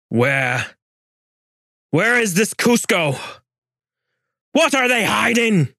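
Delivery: high, rising furious questions; furious demand. A narrator's reading. Gonzalo screaming his last demand at the Inca. Final demand before killing.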